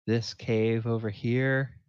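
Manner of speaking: ordinary delivery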